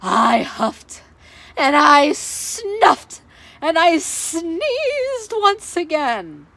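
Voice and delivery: loud, slow, drawn out; dramatic huffing. Acted. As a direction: build up to a huge sneeze.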